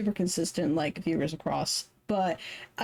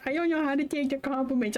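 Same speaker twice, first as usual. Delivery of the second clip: a lightly put-on shy voice, faster; 7 semitones higher, faster than usual. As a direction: mimic a bashful friend, quick and light.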